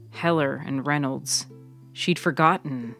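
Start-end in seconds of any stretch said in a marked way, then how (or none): none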